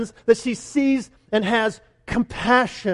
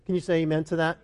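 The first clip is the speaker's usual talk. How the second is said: ordinary talk, nothing marked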